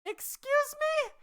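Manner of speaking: very high, drawn-out indignation; re-enacted offended reaction; affronted disbelief. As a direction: stretch it out in squeaky disbelief. Affronted reply to accusing aunt.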